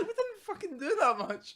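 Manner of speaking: giggling through the words; laughing protest. Retold or imitated.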